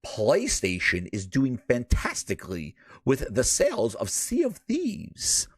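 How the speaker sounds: low, over-enunciated mock executive, rising on Thieves; ultimate clarity. Host imitating Satya praising PlayStation sales, ultimate clarity. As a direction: savor each word with exaggerated precision. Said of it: "you said a full word without stuttering".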